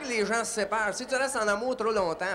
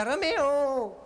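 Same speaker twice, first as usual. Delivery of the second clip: high, drawn-out lovesick cry of a name; calling out Juliet's cry; 7 semitones higher, much slower than usual. Acted. Juliet on the balcony calling her lover, theatrical high cry. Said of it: "elle criait".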